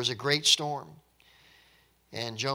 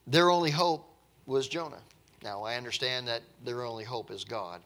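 ordinary talk, nothing marked

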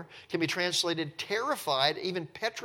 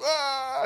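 high wordless wail, put on; hammed-up "ah" of a breakdown; mock wail; 10 semitones higher, 4 dB louder than usual. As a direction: imitate someone bursting out wailing. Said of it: "he's wailing".

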